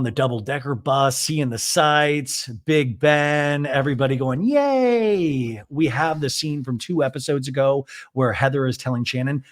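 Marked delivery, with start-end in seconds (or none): 4.4-5.6 high, drawn-out squeal of a cheer; hammed-up group excitement; mock cheer. Host imitates housewives cheering on the bus, mock high cheer.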